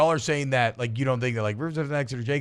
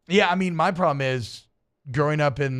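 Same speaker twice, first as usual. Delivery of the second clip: ordinary talk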